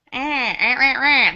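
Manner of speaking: drawn-out, loud nasal mocking; super nasal. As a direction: mimic an irritating nasal voice, dragging it out. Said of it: "She's, like, super nasal".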